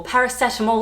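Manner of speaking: paracetamol as para-SET-a-mol; short e in "paracetamol", like "set"; British paracetamol. Shown as a demonstration. Vlogger naming another hard word, British paracetamol. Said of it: "I wanna say paracetamol".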